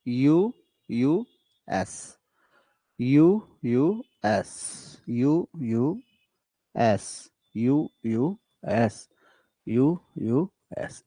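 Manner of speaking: "यू, यू, एस" in measured groups, each letter set apart; metrical pattern demo. Shown as a demonstration. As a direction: slowly count out "यू, यू, एस" with a short pause before "एस". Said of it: "दो unstressed फिर stressed".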